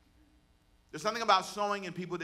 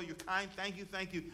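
normal talking voice

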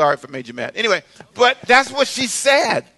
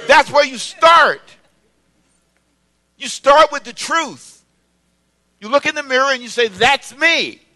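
raised, loud, punchy declarations; emphatic preaching; 4 semitones higher, 4 dB louder than usual. Real, not acted. Preacher tells congregation where to start, emphatic preaching. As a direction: drive each phrase home from the pulpit. Drives the point home after chant.

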